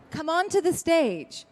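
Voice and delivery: singsong verse reading; reading verse aloud. Acted. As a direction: read it like a children's rhyme. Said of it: "And it starts like this".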